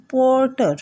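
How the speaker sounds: পর্টার with the R left silent, high and falling. Shown as a demonstration. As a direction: soften away the R in পর্টার. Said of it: "silently হয়".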